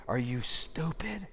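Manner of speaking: slow, hushed disbelief; incredulous whisper. Acted. Preacher to the chief priests who deny their king, incredulous whisper. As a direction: whisper it slowly, aghast at them.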